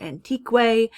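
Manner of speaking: ANTIQUE wrongly ending "an-TEEK-way"; mispronounced with qu. Shown as a demonstration. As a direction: add a "kway" to the end of ANTIQUE. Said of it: "We don't say antique".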